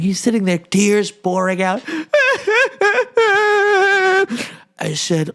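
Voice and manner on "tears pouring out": mock crying. Mock crying boy story.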